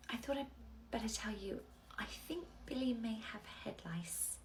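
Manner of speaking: confidential whisper; nursery worker whispering bad news to a parent. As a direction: break it gently in a whisper.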